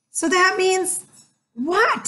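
put-on a young girl pushing him; curious girl. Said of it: "said Annie".

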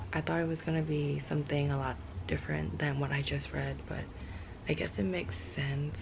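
ordinary talk

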